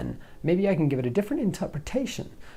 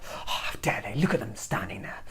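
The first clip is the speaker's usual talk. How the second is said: low, hushed seething; quiet fuming under the breath; 1 seething whisper; 5 semitones lower, 7 dB quieter than usual. Retold or imitated. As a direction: hiss it through your teeth. Seething inner voice.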